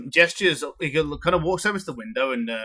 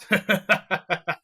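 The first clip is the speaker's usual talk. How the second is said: tavern keeper laugh; 6 semitones higher than usual. Acted. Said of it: "He goes".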